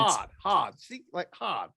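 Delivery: "hard" said Boston-style as "hahd". Shown as a demonstration. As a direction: drop the R in "hard", open vowel. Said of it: "Nobody uses an awe".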